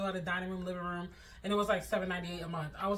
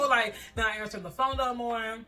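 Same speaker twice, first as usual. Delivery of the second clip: ordinary talk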